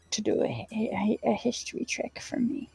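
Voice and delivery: soft, voiceless whisper. Real, not acted. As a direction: whisper the request quietly. Whispered request to the GM.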